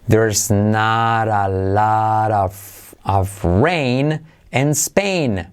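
very slow English model sentence, drawn out word by word; very slow demo. Shown as a demonstration. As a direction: read it out slowly for learners. (frase en inglés muy lenta)

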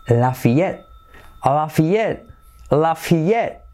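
LAFAYETTE as "la-fee-YET", silent ending; correct English pronunciation. Shown as a demonstration. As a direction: model LAFAYETTE repeatedly, "fee" in the middle. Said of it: "cómo se pronuncia".

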